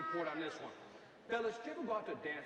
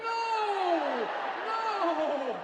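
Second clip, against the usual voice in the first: anguished shout; 11 semitones higher, 9 dB louder than usual. Acted. DJ stops music at the win.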